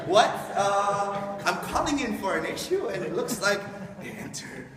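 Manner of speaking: confused caller. Retold or imitated.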